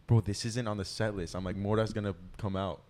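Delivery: ordinary delivery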